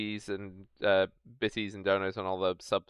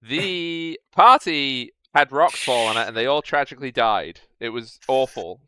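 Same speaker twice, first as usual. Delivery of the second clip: mock tragic recap; 7 dB louder than usual.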